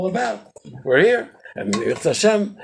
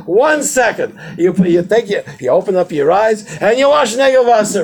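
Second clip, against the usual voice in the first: emphatic; 6 semitones higher, 7 dB louder than usual. Real, not acted. Lively rhetorical challenge.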